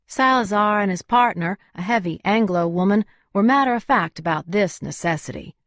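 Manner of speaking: ordinary delivery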